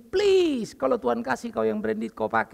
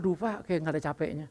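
a soft, lightly voiced admiring sigh; people's remark; 4 semitones lower, 5 dB quieter than usual. Preacher quoting his church folk, softly admiring. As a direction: murmur it like the congregation marvelling. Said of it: "Sampai kadang-kadang".

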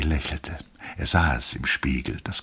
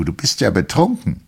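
higher, louder scorn; wife accuses husband of being drunk, higher and louder; scornful wife; 5 semitones higher, 5 dB louder than usual. A narrator's reading. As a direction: throw the accusation at him. Wife's scorn.

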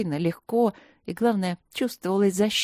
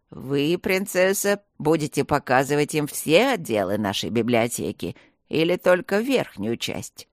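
talking tree; 4 semitones lower than usual. Acted. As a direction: speak like an old calm tree. Tree character voice.

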